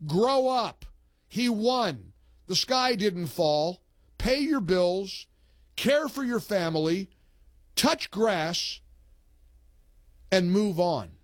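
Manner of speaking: host reads a listener's post to the audience, slow and pointed; reading a post aloud. Retold or imitated. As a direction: read the post like a scolding sermon. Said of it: "Isn't that a great post".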